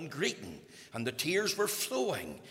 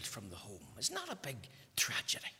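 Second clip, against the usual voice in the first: whisper; 7 semitones lower, 10 dB quieter, faster than usual. Real, not acted. Grief-laden question to congregation.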